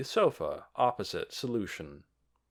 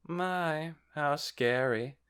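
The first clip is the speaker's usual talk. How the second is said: slow, teasing "how scary"; mock fright; 6 semitones higher, much slower than usual. A narrator's reading. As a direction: pretend to be scared. Solution mocks the threat.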